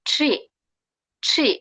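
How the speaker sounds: ট্রি with T and R run into one sound; T and R together. Shown as a demonstration. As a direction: model ট্রি as one fused onset. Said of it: "টি আরটা একসাথে উচ্চারণ হবে".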